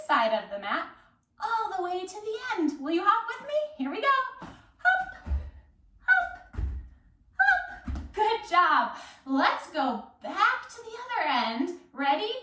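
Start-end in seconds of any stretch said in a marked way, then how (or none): 4.4-8.1 short, very high-pitched hops; bouncy hop calls. Pretend. Teacher hops along the mat with children, high bouncy calls. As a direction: pop each hop out high and separate. Calling out hops while jumping.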